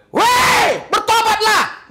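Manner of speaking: high, loud shout of a call to repent; harsh-sounding call. Shown as a demonstration. Preacher showing a harsh-sounding call to repent, shouted. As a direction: bark it like a stern preacher. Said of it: "Bukan kasar, bagi Tuhan itu halus".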